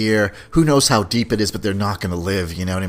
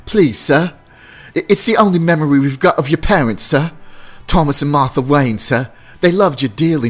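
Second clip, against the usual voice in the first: higher, pleading butler impression; Michael Caine; 3 semitones higher, 3 dB louder than usual. Butler reminding his master of his parents, pleading impression. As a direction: plead like a devoted English servant. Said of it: "Michael Caine was always there to remind him".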